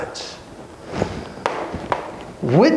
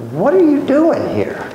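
God questioning; 9 semitones higher than usual. Retold or imitated. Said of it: "He goes".

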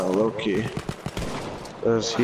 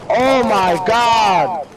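long drawn-out shocked cry; 9 semitones higher, 7 dB louder, much slower than usual. Real, not acted. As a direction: stretch it out in disbelief. Sudden firefight shock.

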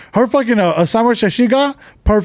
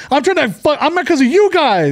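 pissed; 6 semitones higher than usual. Retold or imitated. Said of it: "I'm pissed 'cause they fucked up".

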